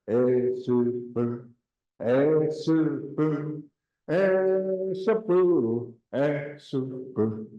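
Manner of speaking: slow repeated Esupu; repeating after teacher. Shown as a demonstration. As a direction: drill Esupu slowly. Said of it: "Say that".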